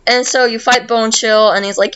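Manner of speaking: usual speaking voice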